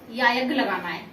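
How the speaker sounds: ordinary delivery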